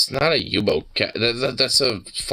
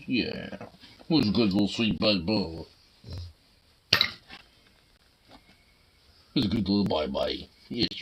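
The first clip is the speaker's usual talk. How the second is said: talking to a dog; 8 dB quieter than usual. Real, not acted. Soft slow cooing at pet.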